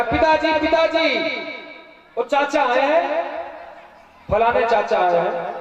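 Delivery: put-on high-pitched calling child. Boy telling his bathing father of a visitor, calling out.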